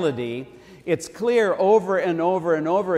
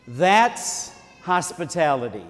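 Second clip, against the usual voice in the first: slow, louder declaration; emphatic; 2 semitones higher, 4 dB louder, slower than usual. Real, not acted. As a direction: land the point slowly. Sermon punchline.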